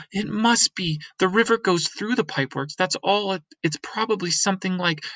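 put-on high girl voice, rising with discovery; a girl sure she's found the way out; excited girl. Girl character realizing excitedly.

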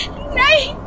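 frantic high screaming plea; terrified scream. A narrator's reading. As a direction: beg for the child's life in pure terror. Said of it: "मैं जोर से चीखी".

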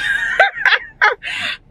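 a high laugh breaking toward a cry; tearful laugh. Real, not acted. Overwhelmed telling viewers her news, tearful laugh. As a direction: laugh that tips into tears. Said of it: "I'm emotional".

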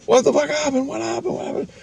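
very high, urgent repeated question, acted out; alarmed questioning. Speaker answering the panicked friend, alarmed questioning.

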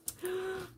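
quick gasp of delight. Real, not acted. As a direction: gasp at the surprise gift. Finds gifted beads.

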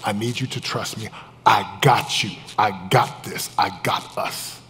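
soft, deliberate reassurance with total conviction; quiet certainty. Retold or imitated. Husband promising his wife he's got this, quiet certainty. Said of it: "it was my certainty".

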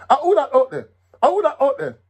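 high, loud challenge into the dark; soldier's call. Acted. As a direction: shout out at the unseen thief. Soldier calls into dark yard.

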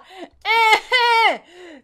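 high, loud wail of frustration; drawn-out angry cry; frustrated cry. Real, not acted. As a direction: let out an exasperated wordless yell. Pissed off, cries out without words.

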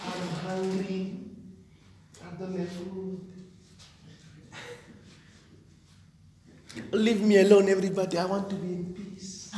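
milk the pity, drooping; mock self-pity whine. Preacher imitating a self-pitying person, soft mock whimper. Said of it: "people who always feel pity for themselves".

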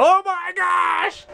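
high, drawn-out loud cry; exclaiming. Pretend. Vlogger showing viewers a work in progress, exclaiming loudly. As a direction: shout it slowly, way up high. Mock alarm at the messy work.

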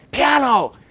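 sharp, loud, high shout across the orchestra; yelled command. Retold or imitated. Conductor yells at the orchestra without stopping, loud high shout. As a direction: bark the cue like a conductor mid-rehearsal. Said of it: "So I yell at them".

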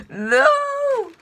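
loud, high no; shocked no. Real, not acted. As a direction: yell at the bad draw. Cinnamon challenge drawn.

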